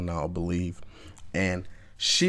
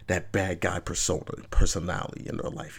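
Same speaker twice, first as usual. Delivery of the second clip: ordinary talk, nothing marked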